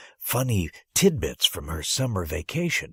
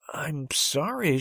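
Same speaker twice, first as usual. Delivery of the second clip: higher, slow, syrupy sorry; mock-sympathetic; 6 semitones higher, slower than usual. Teacher pitying the boy in the wrong class, sugary. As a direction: apologize as if to a baby. Teacher breaking bad news.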